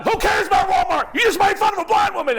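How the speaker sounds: high, loud indignant crowd shout; fast, furious crowd shout; crowd yelling. Retold or imitated. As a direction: yell it like an outraged audience.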